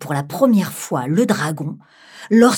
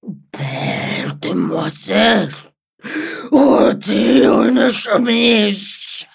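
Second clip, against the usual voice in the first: slow, wailing dragon demand; drawn-out moaning dragon; 4 semitones higher, 4 dB louder, much slower than usual. Acted. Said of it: "le dragon dit pour la troisième fois".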